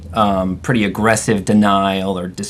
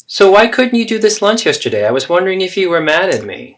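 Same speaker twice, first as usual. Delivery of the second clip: anxious mother; 9 semitones higher than usual. Acted. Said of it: "So there you have the three role plays".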